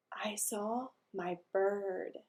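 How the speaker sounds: hushed excited whisper. Acted. Little boy whispering his secret news.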